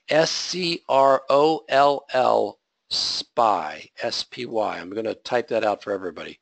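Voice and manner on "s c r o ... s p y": spelling out slowly. Shown as a demonstration. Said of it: "the term for that is called scroll spy".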